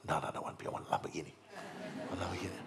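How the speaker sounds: low, soft, hurried brush-off; dismissive refusal. Acted. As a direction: play a stubborn seeker muttering no. Acts out stubborn seeker refusing peace.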